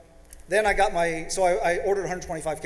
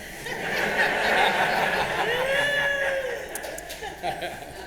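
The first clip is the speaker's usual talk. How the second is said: big laugh. Real, not acted. Telling the audience his wife's jab, bursting out laughing. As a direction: break into open laughter at the punchline.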